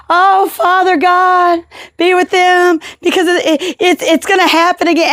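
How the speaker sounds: high, hammed-up pleading prayer voice; mock dramatic prayer. A stranger in line praying over the seizure, hammed-up high pleading. Praying bystander, hands in the air.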